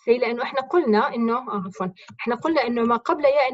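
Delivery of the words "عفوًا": normal talking voice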